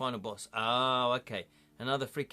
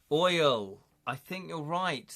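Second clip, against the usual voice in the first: ordinary talk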